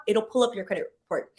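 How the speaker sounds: ordinary talk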